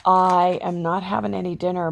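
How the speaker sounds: ordinary talk, nothing marked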